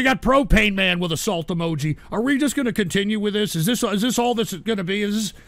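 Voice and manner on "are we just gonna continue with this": usual speaking voice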